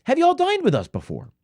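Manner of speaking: high, sing-song server greeting; bubbly server. Retold or imitated. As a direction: chirp it like a peppy waiter. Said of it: "the bubbly server comes over".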